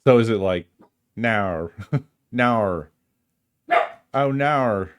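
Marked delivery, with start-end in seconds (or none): 1.1-4.9 mock New Zealand accent, laughing through it; a playful Kiwi now, now, oh, now. Imitating how Bluey might sound, mock New Zealand accent. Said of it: "The New Zealand accent".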